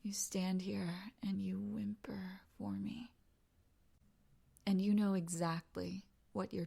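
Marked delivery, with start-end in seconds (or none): 0.0-3.1 soft, half-whispered tease; intimate accusation. Acted. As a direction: accuse them in a hushed voice. Acted intimate accusation of whimpering.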